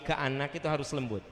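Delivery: ordinary talk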